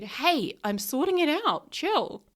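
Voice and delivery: mock the partner waving her off; dismissive brush-off. Partner tells her to chill, imitated offhand.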